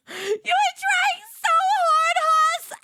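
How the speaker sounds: high, slow, laughing teasing; mocking laugh. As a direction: cackle the tease up high.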